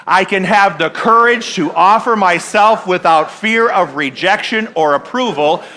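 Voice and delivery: slow, firm call and response; leading a group affirmation. Shown as a demonstration. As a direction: lead them in saying it together. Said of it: "Let's say that together".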